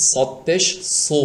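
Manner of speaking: সদ and সু said with a full, heavy s; thick সদ. Shown as a demonstration. Teacher models the correct সদ, thick and heavy. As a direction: make সু dark and rounded. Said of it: "এটা হবে এরকম".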